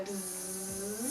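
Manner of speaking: long buzz swooping up and down; flea buzz. Shown as a demonstration. Class follows the flea's flight, gliding buzz. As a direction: buzz rising and falling with the flea. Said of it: "our voice will go up".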